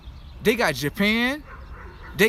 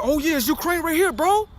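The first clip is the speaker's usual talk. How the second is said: mock clueless viewer; 8 semitones higher than usual. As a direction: play the duped guy pointing at the map, pitched up. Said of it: "And you gonna be like".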